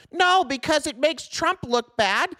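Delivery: very high-pitched, drawn-out caricature; hammed-up high mocking voice; mock objector voice. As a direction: mimic a complaining viewer in a squeaky put-on voice. Mimics an objecting viewer in mock voice.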